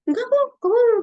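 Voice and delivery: high, loud quick denial; defensive denial. Retold or imitated. Woman brushing off someone's concern, high and loud. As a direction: snap back that nothing's wrong.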